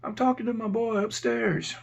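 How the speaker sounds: high-pitched put-on female voice; imitated feminine inmate. Inmate telling the officer about his friend upstairs, high imitated voice.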